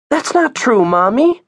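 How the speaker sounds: put-on very high child's protest. Via contradicting Lourdes to her mom, protesting child. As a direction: object in a high little-girl voice.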